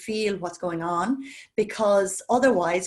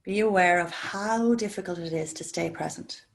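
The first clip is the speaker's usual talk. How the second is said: guided meditation. Acted. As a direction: ease the class into calm breathing, gently. Leads a guided breathing meditation.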